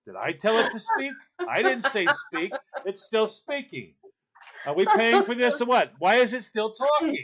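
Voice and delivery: lightly voiced dad huffing about the bride; scornful father. Bride's father complains to staff, scornfully put out. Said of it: "Look at her husband. Go".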